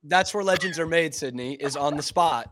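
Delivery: ordinary delivery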